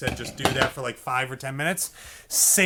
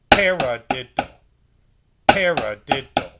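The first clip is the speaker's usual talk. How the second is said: Paradiddle, louder, PA accented; Paradiddle, accented first beat; counted paradiddle; 4 dB louder than usual. Shown as a demonstration. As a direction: call out the rhythm. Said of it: "then diddle in the same stick".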